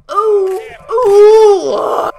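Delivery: high, loud delighted ooh; thrilled high cry; excited squeal. Real, not acted. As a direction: squeal with joy. Excited reaction to flips.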